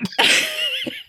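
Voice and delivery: high-pitched burst of laughter. Real, not acted. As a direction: squeal with laughter. Laughing at joke.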